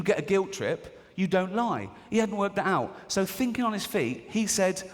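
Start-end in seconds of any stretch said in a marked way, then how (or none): none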